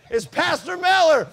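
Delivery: loud, slow shout at the intercom; yelling into the speaker. Retold or imitated. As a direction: call it out slowly and loudly, like the speaker is far off. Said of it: "Dad got down there and said".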